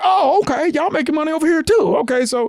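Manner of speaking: high-pitched mock realization; acted pleased discovery. Teen finds east side guys making money too, high delighted realization. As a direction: imitate catching on, pitch way up.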